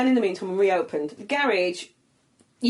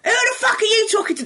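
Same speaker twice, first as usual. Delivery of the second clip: higher, louder hammed-up angry yell; angry stranger shout; 14 semitones higher, 5 dB louder than usual. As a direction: mimic a furious stranger yelling back. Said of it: "I heard someone go".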